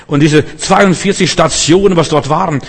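ordinary delivery

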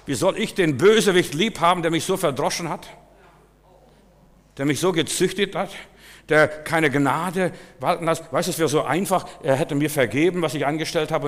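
lauter und höher, aufgewühlt predigend; Predigt mit voller Kraft. Acted. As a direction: die Empörung von der Kanzel rufen. Impassioned preaching about his father. (lauter, leidenschaftlicher Predigerton)

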